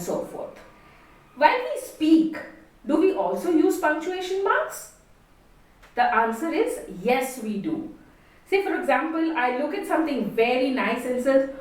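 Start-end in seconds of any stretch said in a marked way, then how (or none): none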